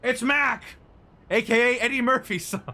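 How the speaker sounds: high, loud, gushing photographer voice; an over-eager paparazzo spotting his big shot; excitable photographer. Acted. Mac introduces himself.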